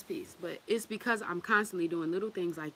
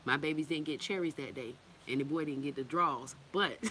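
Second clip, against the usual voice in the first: ordinary talk, nothing marked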